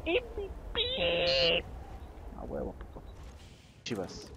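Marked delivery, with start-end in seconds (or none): 0.1-3.0 high, slow sing-song gloat. Real, not acted. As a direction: draw it out smugly. Gloating about MVP.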